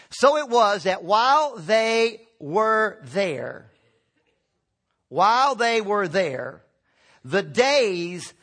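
very slow, weighty, word by word; emphatic scripture reading. Real, not acted. Preacher reading Luke to prove they were already there, slow and emphatic. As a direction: stretch out each word to drive it home. Reads scripture, hammering the key phrase.

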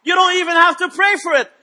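high, loud declaiming sermon voice; full-force preaching to the congregation. Real, not acted. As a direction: stretch the scripture out and hammer the key words.

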